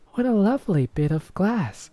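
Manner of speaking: put-on high, delighted little girl; little girl crying out. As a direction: squeal it like a delighted child. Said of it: "cried the little girl".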